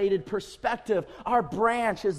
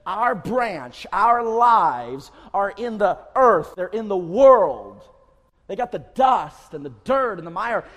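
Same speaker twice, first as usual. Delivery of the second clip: much louder, slow on "our lives are in the earth"; forceful preaching; 8 dB louder than usual. Real, not acted. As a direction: drive the words home. Preacher emphasising worldly mire.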